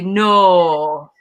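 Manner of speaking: a punchy Oldham "no" with a broad, exaggerated O; Oldham accent. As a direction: hit "no" loud with a thick Oldham O. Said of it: "I exaggerate my O's".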